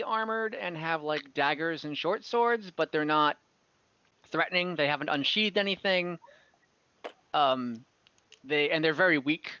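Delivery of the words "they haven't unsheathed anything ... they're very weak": ordinary talk, nothing marked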